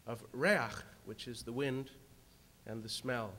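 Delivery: usual speaking voice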